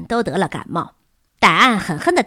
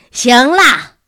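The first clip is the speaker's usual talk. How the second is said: loud, raised-pitch outburst; yelling; 4 semitones higher, 9 dB louder than usual. A narrator's reading. Worn-out teacher stopping the students, yelled. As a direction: cut them off with a yell. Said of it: "维茨尔先生叫道".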